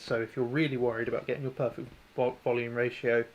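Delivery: ordinary talk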